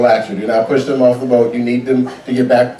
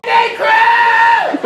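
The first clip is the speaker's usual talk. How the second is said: very high, hollow cheer; exhausted parent faking joy; tired fake cheer. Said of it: "They're like".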